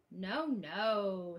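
ordinary talk, nothing marked

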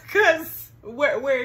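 cracking up asking where; amused disbelief; laughing. Real, not acted. Mocking the missing ring.

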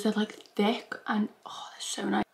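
soft whisper of delight. Real, not acted. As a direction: whisper it like a secret treat. Whispering praise while eating.

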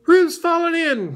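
high, loud alarm call; crying out. A narrator's reading. Rabbit alerts everyone that Roo fell in, crying out. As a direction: raise the alarm in a high cry. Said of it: "cried Rabbit".

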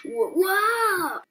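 high shocked yelp; startled cry. Acted. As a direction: jolt of fright, cry out. Startled in the game.

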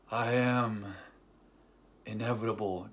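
slow, measured pronouncement; lightly voiced menacing declaration; Thanos. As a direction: draw it out with pauses, lightly acted villain.

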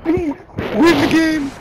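frantic high yelling; panicking gamer. Real, not acted. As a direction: scream at your teammate to win. Pleading drawn-out.

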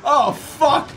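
high, loud panicked shout; alarmed shout. Real, not acted. Gamer trapped in the game, shouting in alarm. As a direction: yell it trapped and scared. Stuck in a creature's stomach.

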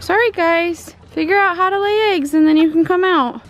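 high-pitched pet talk; high singsong apology to pets; talking to animals. Real, not acted. Talking to the chickens.

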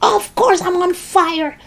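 very high exasperated yell; indignant yell. A narrator's reading. Grandma smoking, answering George, yelling. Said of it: "she yelled".

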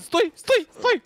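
high urgent calling out; urgent shout. Real, not acted. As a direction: yell at them to stop. Monster spotted, calling out.

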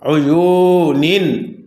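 "উয়ুনিন" slowly, holding the middle u long; tajweed reading of "উয়ুনিন" with a stretched u; elongated vowel. Shown as a demonstration. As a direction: draw out the yu in "উয়ুনিন", then close on nin. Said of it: "এক আলিফ টানিয়া পড়িতে হইবে".

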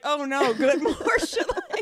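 high, loud, laughing dog voice; voicing the dog. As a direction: put words in the dog's mouth, high-pitched and giggly. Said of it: "He's like".

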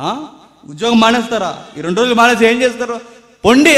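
wife pushing her husband out the door, scolding; shooing wife. Retold or imitated. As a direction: shoo him off to work.

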